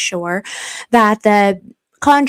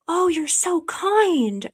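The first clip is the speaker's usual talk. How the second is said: normal talking voice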